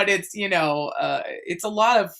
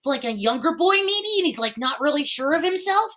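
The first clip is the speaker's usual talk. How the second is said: put-on higher, nasal young boy voice; unsure young boy; 7 semitones higher, faster than usual. Shown as a demonstration. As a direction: slip into a shy younger boy as you explain. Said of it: "when I'm doing a boy voice".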